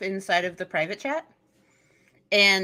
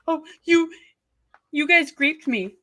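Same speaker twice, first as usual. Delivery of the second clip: mocking quote; 5 semitones higher than usual. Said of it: "she's being so belligerent".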